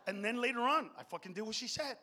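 usual speaking voice

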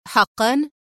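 lightly sarcastic question; mocking. A narrator's reading. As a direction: feign interest with a touch of scorn. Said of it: "وقال في سخرية". (بسخرية خفيفة)

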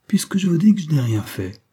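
irritated. Acted. Narrator cuts off the old professor, mildly irritated. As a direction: interrupt him with mild annoyance. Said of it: "le coupai-je avec un peu d'humeur".

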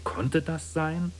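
viel höher und langsam, „sein“ lang gedehnt; „Konnte das sein?“ hoch und gedehnt fragen; ungläubig. A narrator's reading. Zweite, ungläubige Fassung der Frage. (staunende, gedehnte Frage)

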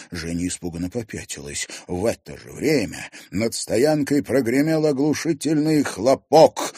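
plain reading voice

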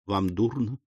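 hushed, worried question; soft concern; alarmed. A narrator's reading. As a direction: ask softly, anxious for him. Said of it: "встревожилась Рэйчел".

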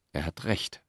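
very soft, weakened voice; coming to. A narrator's reading. Cicero, just recovering from collapse, concedes faintly. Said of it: "als sei er in dieser Sekunde wieder".

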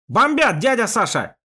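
high, loud shout over an engine. Acted. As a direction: yell it over the roaring motor. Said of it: "проорал Яшка".

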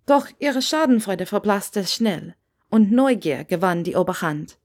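usual speaking voice

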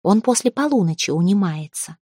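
ordinary delivery

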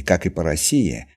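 ordinary delivery

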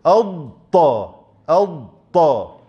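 أضطا slowly, ض then ط added; slow drill. Shown as a demonstration. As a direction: stretch أضطا, clean ض then ط. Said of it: "أدخل الطاء بعد ذلك".

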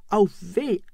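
high pained cry; screaming in pain. Acted. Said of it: "schrie weiter". (hoher Schmerzensschrei)

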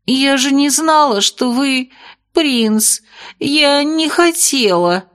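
high, trembling plea. A narrator's reading. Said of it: "И ещё жалобней".

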